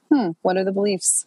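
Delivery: ordinary delivery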